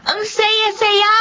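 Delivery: put-on high, happy child cheer; child's joy. The girl cheers on coming home, excited. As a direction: squeal with delight, drawing it out slowly.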